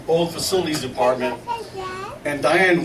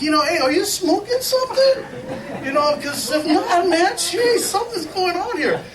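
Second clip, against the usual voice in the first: high-pitched mock suspicion; hammed-up incredulous questioning; comic incredulity. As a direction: question them in exaggerated, high disbelief. Retold incredulous questioning of colleague.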